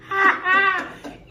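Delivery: a high, loud burst of laughter; bright delighted laugh over the trick. Real, not acted. Laughing at her own trick.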